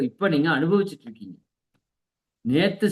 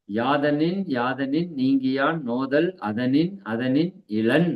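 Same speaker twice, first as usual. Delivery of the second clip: slow, measured couplet recital; kural recitation; 3 semitones lower, slower than usual. Acted. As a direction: recite the couplet slowly, word by word. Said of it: "இதுக்கு ஒரு குறள் இருக்குது". (நிதானமாக குறள் ஓதுதல்)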